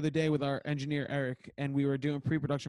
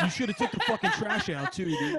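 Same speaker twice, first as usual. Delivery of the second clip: mock nagging spouse.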